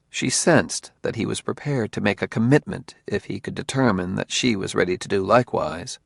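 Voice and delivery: ordinary delivery, nothing marked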